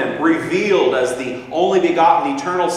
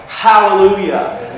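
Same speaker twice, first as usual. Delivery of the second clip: raised, loud cry of praise; exultant hallelujah; 4 semitones higher, 6 dB louder than usual. Real, not acted. Preacher celebrating what Jesus' name means, exultant. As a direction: let the praise ring out, drawn out.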